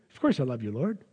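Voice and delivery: quiet, easy first answer; calm reply. Acted. Peter quietly answers Jesus the first time, calm and soft. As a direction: answer softly, nothing doubted yet.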